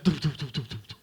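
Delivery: soft doof doof noises; thudding sound effect. Retold or imitated. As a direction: mimic a thudding turtle. Turtle thudding over fence.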